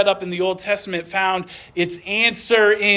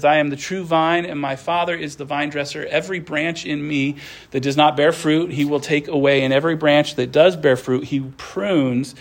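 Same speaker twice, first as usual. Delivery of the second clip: lower, measured Bible reading voice; scripture reading; 6 semitones lower than usual. Acted. Pastor reading scripture aloud to the congregation, reverent and measured. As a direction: read the words of Jesus with reverence.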